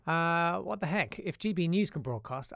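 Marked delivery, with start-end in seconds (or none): none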